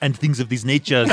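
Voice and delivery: put-on comic Austrian-accented catchphrase; Arnold. Adds the suggested catchphrase on request, Austrian accent. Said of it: "So I want you to start throwing that on".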